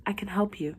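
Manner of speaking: whispered gentle offer; soft whisper. Acted. Doctor offering help to the patient, whispered. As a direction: hush it, almost pleading.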